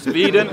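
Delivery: Sweden said as "Sveden", a V sound replacing the W; mock V for W. Mocking the rival's accent to the crowd, Sweden said "Sveden". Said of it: "pronounce your W's as V's".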